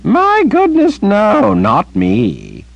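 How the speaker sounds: very high, slow feigned innocence; innocent Zed. Acted. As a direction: play the wide-eyed old innocent. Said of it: "Zed shrugged innocently".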